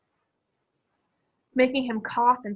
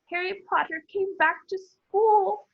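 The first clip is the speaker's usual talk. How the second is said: high, slow, miserable elf voice; a small house elf on the verge of tears; 9 semitones higher, slower than usual. A narrator's reading. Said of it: "he whispered miserably".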